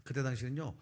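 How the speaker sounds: usual speaking voice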